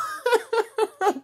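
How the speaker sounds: high cracking-up laugh; helpless high giggling fit; real laughter. Real, not acted. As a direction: burst out laughing at your own impression. Cracks up at own imitation.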